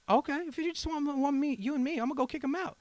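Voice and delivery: high-pitched, put-on eager voice; mock eager agreement. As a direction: act out jumping at the offer in a high voice. Retold eager answer to her.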